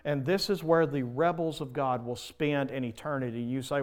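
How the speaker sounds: ordinary talk, nothing marked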